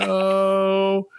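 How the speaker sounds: hammed-up scared no; mock nervous no. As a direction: squeak it out. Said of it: "I'm like".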